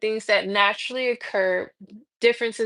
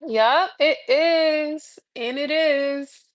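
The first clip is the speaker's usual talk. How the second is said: breezy yep; 4 semitones higher, much slower than usual. Retold or imitated. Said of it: "I'm like".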